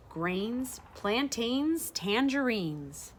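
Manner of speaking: usual speaking voice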